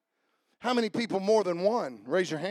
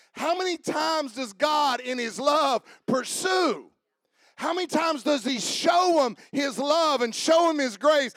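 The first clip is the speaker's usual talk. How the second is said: higher, louder shouted preaching building to a peak; preaching at full force; 8 semitones higher, 7 dB louder than usual. Acted. Preacher to congregation, climbing shouted climax. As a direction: drive it home to the crowd, climbing.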